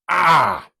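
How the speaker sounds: loud agonized cry; pained loud scream. Acted. Boy transforms in pain, loud scream.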